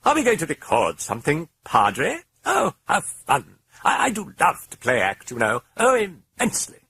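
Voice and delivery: put-on high, loud, delighted accent; theatrical British glee; playful British voice. A narrator's reading. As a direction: relish the game.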